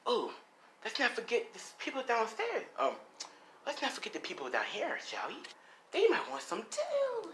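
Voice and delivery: singsong threat rising into a squeak; sinister playful Gremlin. Acted. Gremlin threatening the people below, sinister and playful.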